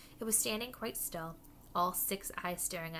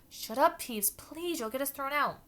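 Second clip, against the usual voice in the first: higher, loud pleading hiss; urgent plea; 7 semitones higher, 7 dB louder than usual. Acted. Begging Peeves to be quiet.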